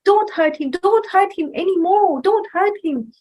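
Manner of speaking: high, loud pleading to stop; storyteller voicing a brother crying out. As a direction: beg him to stop the beating. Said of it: "And then he said".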